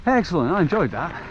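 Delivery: real joy at making the turn; delighted. Real, not acted. First clean run round the section.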